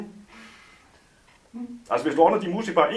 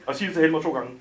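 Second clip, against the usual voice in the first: ordinary delivery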